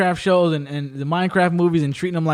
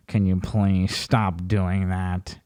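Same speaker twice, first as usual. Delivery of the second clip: low, slow, pleading mock voice; whiny VR user; 9 semitones lower, 5 dB quieter, much slower than usual. VR user begging a troll to stop, slow low plea. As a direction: drag it out like a wounded gamer.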